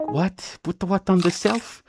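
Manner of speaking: high, puzzled questions; mock confusion. Retelling confusion at a friend's odd "shelf". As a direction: play the baffled listener, pitch up.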